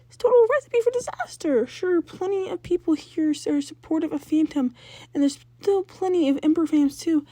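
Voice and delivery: put-on older sister warning her brother about ghost hunters; worried older sister. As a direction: lecture him like a frantic big sister. Jazz voiced lecturing her brother.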